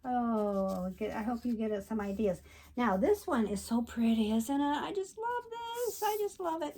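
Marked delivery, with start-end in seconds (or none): 4.8-6.8 high, delighted gushing. Real, not acted. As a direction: squeal with delight over it. Gushing over pretty card.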